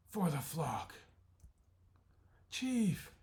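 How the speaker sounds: loud, high battle cry; heroic shout. Acted. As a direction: cry it out as he holds the tree. Chief's last stand.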